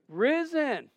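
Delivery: high, ringing "Risen"; proclaimed. Shown as a demonstration. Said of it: "answer proclaimed".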